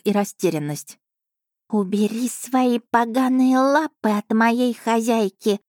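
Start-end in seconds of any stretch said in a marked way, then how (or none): 1.7-5.6 higher, slow cat voice full of menace; slow menacing threat. Acted. The cat menacing the beast to let go of her mistress, slow. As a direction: threaten him slowly, every word a warning. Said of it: "Тихо, медленно и очень грозно".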